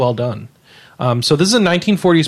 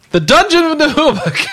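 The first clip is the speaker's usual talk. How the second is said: excited announcer; 12 semitones higher, 3 dB louder than usual. Acted. Jingle announcing the good reviews.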